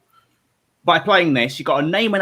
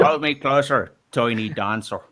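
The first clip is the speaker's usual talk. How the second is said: Northern Irish accent. Shown as a demonstration. Host doing requested lyric as phone threat, Northern Irish. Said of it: "[name] lyric in a Northern Irish accent".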